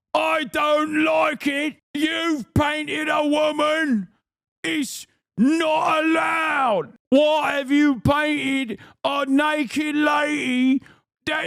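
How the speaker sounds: high, slow shouted British caricature; medieval British accent. Acted. Medieval gooner scolding Manet over a nude, high slow hammed-up British accent. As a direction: wail it out as an outraged medieval Brit, vowels dragged long. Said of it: "medieval gooner is always gonna be a British accent".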